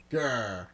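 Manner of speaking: a low growl from the throat; low tiger growl. Acted. The circus tiger stalking the lawyer, low growl. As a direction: growl like a big cat.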